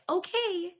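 mock teacher questioning her fiancé. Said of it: "I feel like a teacher".

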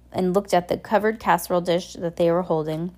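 usual speaking voice

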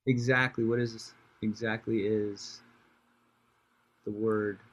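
slow, low-key mock confusion; halting, softly pretending to be clueless; feigned puzzlement. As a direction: puzzle over a word you pretend not to know. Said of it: "I was just pretending I didn't know".

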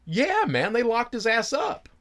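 high put-on voice for the informant; high-pitched retold informant; informant's voice. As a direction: voice the tipster up high.

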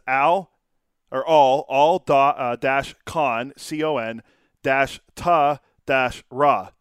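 reading out the spelling of al, con, ta, ra piece by piece; slow, separated syllables: al, dash, con, dash, ta, dash, ra. Shown as a demonstration. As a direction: spell out the pronunciation guide deliberately. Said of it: "right here on Baseball Reference, it says".

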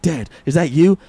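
high, loud pleading son; breathless high son reunion cry; son's voice. Acted. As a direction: voice the grown son finding dad.

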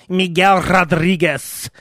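put-on Miguel Rodriguez rolled out slowly with a Spanish accent; Spanish accent on the name. Newsreader mocked for saying Miguel Rodriguez with a Spanish accent. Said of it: "toss in the accent just for the name".

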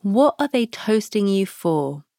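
ordinary delivery

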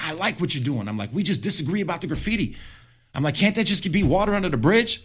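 retold shouting. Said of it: "I started shouting at him".

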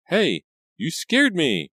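high, slow startled cry out. Acted. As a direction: jump and cry out in fright. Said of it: "she cried out, startled".

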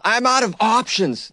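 slow, high pity-me mockery; mocking whine. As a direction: drag out "I'm out of options" high and whiny. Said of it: "Everybody's cheating on him".

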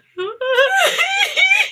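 real high, loud laughing fit; squealing laugh. Real, not acted. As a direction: burst into a shrieking laugh mid-word. Reader cracks up mid-line.

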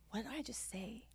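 unvoiced whisper of sudden realization; whispered to herself. Realizing her own words in front of her coach, whispered. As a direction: breathe it out as if caught red-handed. Caught herself, retold inner thought.

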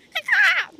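high squawking caw-caw; seagull caw. Shown as a demonstration. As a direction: screech like a seagull. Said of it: "I'll do it".

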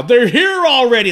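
high, forceful shout, short of a full scream; echoed movie yell. Retold or imitated. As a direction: call it out urgently as your own warning. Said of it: "one of the things he's yelling".

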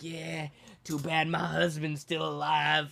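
slow, put-on Southern flirt; creepy flirting woman. Waitress hinting to a customer, imitated slow flirting. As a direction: imitate her drawing it out suggestively. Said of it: "like, she was like".

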